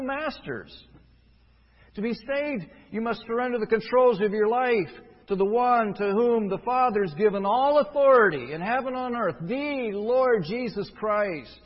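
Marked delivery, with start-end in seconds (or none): 1.9-11.6 louder and higher, slowing hard on the Lord Jesus Christ; forceful preaching. Acted. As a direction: press it home, word by word at the end. Preacher demands surrender to Christ.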